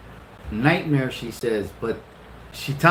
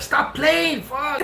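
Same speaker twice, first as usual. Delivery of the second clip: high, exasperated mock snap; fed up with the joke, voice up high; 13 semitones higher, much slower than usual. Husband tells laughing wife to quit, exasperated.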